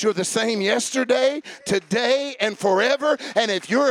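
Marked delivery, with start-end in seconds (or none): none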